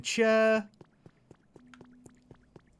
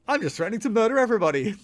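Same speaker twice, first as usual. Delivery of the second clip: loud joking rant; 8 semitones higher, 7 dB louder, much faster than usual. Acted. Comic exaggerated outburst.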